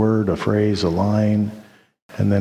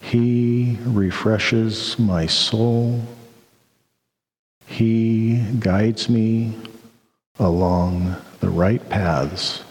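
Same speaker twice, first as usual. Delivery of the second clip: slow, deliberate psalm reading with long pauses; slower than usual. Shown as a demonstration. Pastor rereading the psalm to the congregation, slowly. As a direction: let each phrase settle, unhurried. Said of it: "I'll read it slowly here".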